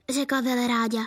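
plain reading voice

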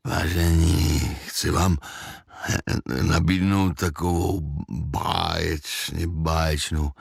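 low, slow, slurred devil voice; heavy-tongued, groggy drawl; thick-tongued babbling. A narrator's reading. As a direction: blather as if dazed, stumbling over words. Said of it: "Těžkým jazykem zablekotal".

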